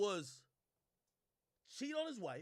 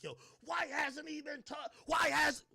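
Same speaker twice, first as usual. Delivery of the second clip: mock shouting fans; 9 semitones higher, 8 dB louder, slower than usual. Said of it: "population of people like".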